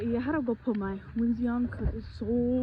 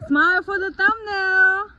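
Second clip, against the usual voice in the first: excited; 9 semitones higher, 6 dB louder than usual. Real, not acted. Posing for thumbnail photo.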